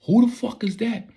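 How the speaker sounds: high, panicked yelp; startled squeak of a question; alarmed. Retold or imitated. Panicked question in retold scene.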